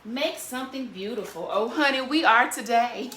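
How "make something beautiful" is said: ordinary delivery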